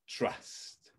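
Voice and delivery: whispered, slow and soft. Acted. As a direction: breathe the word trust as a whisper. Key word, set apart.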